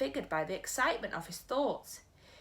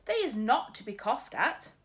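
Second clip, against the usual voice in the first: folksy giant, slightly higher and earnest; BFG giant voice; 6 semitones higher than usual. Acted. Giant telling Sophie about his ears, earnest. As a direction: play the big friendly giant proudly boasting.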